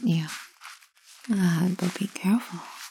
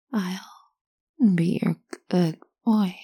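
half speed, voiced and drawn out; slow voiced mantra; much slower than usual. Shown as a demonstration. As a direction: chant it slowly like a mantra. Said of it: "Start saying that".

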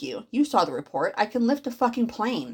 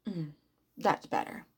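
whispering; 6 semitones lower, 11 dB quieter than usual. Acted. Pleased stepmom murmurs approval.

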